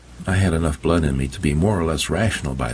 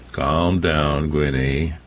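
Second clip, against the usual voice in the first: gruff, steady man's voice; calm her down gruffly; Henry reassuring his wife; gravelly old man; much slower than usual. Acted. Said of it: "Henry's voice was like gravel".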